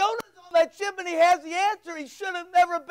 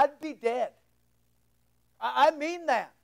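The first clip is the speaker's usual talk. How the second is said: normal talking voice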